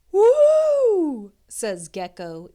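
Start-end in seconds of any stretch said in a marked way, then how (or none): 0.1-1.3 high, loud whoop of delight; impressed woo. A narrator's reading. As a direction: gecko hooting at the beauty, loud and high.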